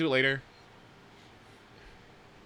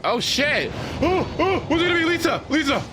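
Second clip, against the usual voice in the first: much higher, louder thrilled yells; yelling a character's name in delight; excited shouting; 13 semitones higher, 5 dB louder than usual. Real, not acted. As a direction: shout with real gacha excitement. Pulling a gacha, reacting.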